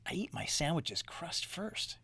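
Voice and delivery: soft whisper of a shy secret; whispered confession. Acted.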